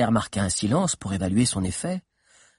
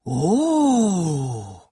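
long, swooping "oooh"; drawn-out audience reaction; crowd "oh"; 5 semitones higher, 3 dB louder than usual. A narrator's reading. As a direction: let an intrigued "oh" rise and slide down. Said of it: "firent quelques voix".

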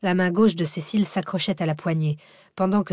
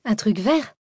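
higher echo question, rising at the end; incredulous question; 4 semitones higher, 3 dB louder than usual. A narrator's reading. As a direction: repeat it in disbelief, VERT rising. Cécile incrédule écarquille les yeux. (question incrédule, montante)

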